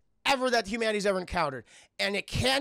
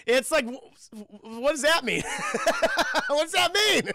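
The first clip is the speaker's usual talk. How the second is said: laughing; 7 semitones higher, 6 dB louder than usual. Real, not acted. Laughing disbelief at dialogue.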